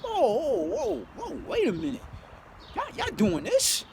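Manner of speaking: high, slowing disbelief; shocked whoa. Retold or imitated. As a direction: back away in stunned wonder. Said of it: "I'm like".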